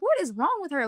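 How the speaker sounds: high-pitched, swooping incredulous question; mock exasperated disbelief, pitched well up; retold bafflement. Relatives standing over the crying girl ask each other, acted bafflement.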